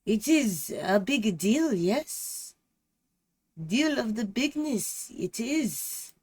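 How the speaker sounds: loud, high hammed-up French detective; exaggerated comic French accent; mock French accent. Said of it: "I wasn't expecting all the accents".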